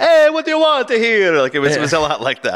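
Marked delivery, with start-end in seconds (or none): 0.0-1.4 loud, very high cartoon Italian accent; pizza parlor Italian accent. Shown as a demonstration. Critic mocking the movie Mario's voice, pizza parlor Italian accent. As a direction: call out in a hammed-up Italian accent. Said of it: "It's the pizza parlor Italian accent".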